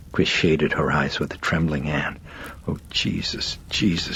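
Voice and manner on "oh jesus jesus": breathy hoarse half-whisper. A narrator's reading. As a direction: gasp it out in shock, hoarsely. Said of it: "she said hoarsely".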